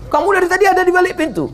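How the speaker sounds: high, fast astonished ask; surprised question. Retold or imitated. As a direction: pertanyaan kaget yang tinggi. Prophet surprised at companion.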